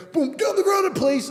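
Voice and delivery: barked high, loud police order; police raid shout. Retold or imitated. Imitating police bursting into his home, loud shout. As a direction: yell it like a cop storming in.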